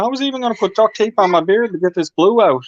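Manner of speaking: high-pitched, loud protest; indignant self-defense. Real, not acted. Teased, defends his love of duct tape.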